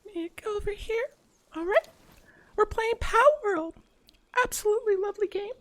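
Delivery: put-on very high cutesy e-girl voice; sweet high-pitched streamer girl. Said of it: "E-grill voice".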